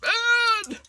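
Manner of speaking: squeaky high scold; high squeal. Real, not acted. Scolding own craft mistake.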